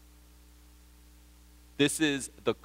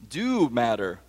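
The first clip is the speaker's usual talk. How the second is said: ordinary talk, nothing marked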